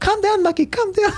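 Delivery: very high pleading mum voice; mum calming dad. Retold or imitated. Mother begs her angry husband to calm down, very high pleading. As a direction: soothe a raging husband, high and urgent. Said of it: "My mum's like".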